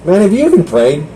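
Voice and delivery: usual speaking voice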